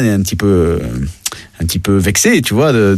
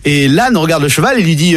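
normal talking voice

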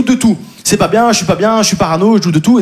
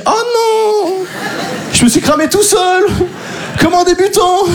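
much higher mock-panicked wail; panicked inner voice; 10 semitones higher, slower than usual. High panicked thought after exposing himself to police. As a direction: scold yourself in a panic. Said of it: "je me dis".

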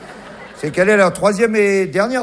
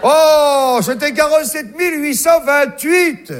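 gleeful host; 6 semitones higher, slower than usual. Retold or imitated. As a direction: crow the winning number with glee. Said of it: "Je vais vous la faire".

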